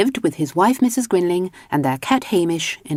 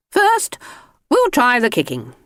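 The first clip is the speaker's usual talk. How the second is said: swimming instructor; 8 semitones higher than usual. Acted. Swimming instructor giving orders.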